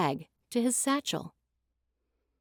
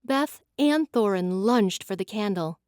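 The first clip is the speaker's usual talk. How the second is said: normal talking voice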